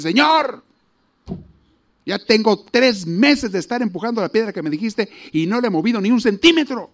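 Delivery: fed-up man yelling his complaint upward; shouting at God. Acted. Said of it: "le grita a Dios".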